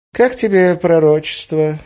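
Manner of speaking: slow, high, offhand question; casual. A narrator's reading. Witch asks about the prophecy, casually. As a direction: toss it out lightly, drawn out. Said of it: "небрежно спросила Арина".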